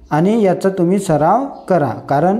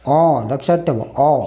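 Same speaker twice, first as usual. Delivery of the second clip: ordinary delivery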